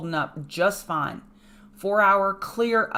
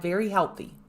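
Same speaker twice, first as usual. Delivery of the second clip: normal talking voice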